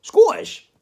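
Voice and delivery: high loud Scottish "Scottish?". Acted. Arguing with a mate over which accent, a loud Scottish query. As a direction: bark "Scottish?" in a high Scots voice. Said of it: "I'll go back to South African".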